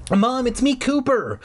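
high, urgent cry to Mom; furious bark. A narrator's reading. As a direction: yelp it frantically, pitched high. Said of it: "I barked furiously".

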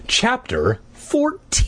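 chapter number drawn out and rising high; hammed-up chapter heading; mock-dramatic announcement. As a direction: announce it slowly, swooping up at the end.

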